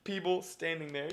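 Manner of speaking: ordinary delivery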